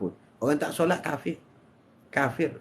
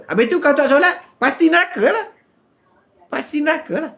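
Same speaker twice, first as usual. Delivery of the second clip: louder, higher, pressing retort; emphatic retort; 8 semitones higher, 5 dB louder than usual. Acted. Preacher fires back at the excuse-maker, loud and emphatic. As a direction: throw it back at them hard.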